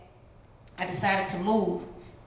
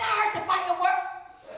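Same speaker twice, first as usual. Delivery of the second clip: naive reply; 11 semitones higher than usual. Retold or imitated. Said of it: "I said".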